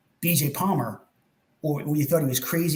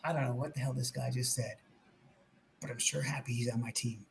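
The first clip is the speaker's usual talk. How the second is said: much softer, lower, a private aside; said to myself; 6 semitones lower, 13 dB quieter than usual. Retold or imitated. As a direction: mutter it to yourself in awe. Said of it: "I swear I said to myself".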